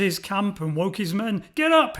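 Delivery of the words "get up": loud high bellow. A narrator's reading. Gideon wakes his sleeping men with a bellow. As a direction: bellow at the top of your lungs. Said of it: "he bellowed at the top of his voice".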